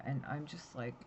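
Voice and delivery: usual speaking voice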